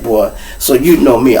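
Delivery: ordinary talk, nothing marked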